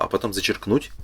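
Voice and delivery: usual speaking voice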